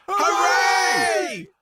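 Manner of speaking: very high joyful shout; children cheering. A narrator's reading. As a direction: cheer like kids set free. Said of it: "The children shouted".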